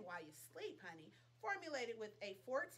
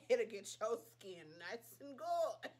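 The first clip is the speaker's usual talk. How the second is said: slow, louder, drawn-out tagline; mock ad slogan; 6 dB louder than usual. Pitching the Farmacy serum to viewers, mock ad slogan. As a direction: sell it like a commercial jingle.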